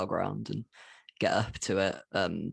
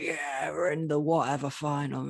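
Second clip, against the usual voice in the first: higher, put-on chanting fan voice; lightly voiced England fan cheering; mock England fan; 6 semitones higher than usual. To a passing Welsh worker, lightly mock England fan gloating. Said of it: "The England fans are going like".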